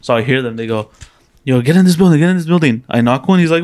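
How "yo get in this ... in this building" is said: louder, higher mock squad command; imitated shouted order. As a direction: act out an urgent order to teammates. Acts out enemy team's orders.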